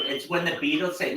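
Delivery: ordinary delivery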